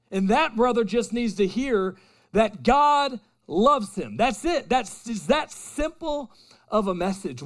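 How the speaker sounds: loud, high, impassioned preaching; rising fervent pulpit cry; preaching at full force. Real, not acted. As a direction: lift the room, pour it out with fire.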